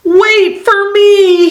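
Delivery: high, drawn-out call; mock shout, high and stretched; storybook shout. A narrator's reading. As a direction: call slowly after friends leaving you behind. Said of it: "he shouts".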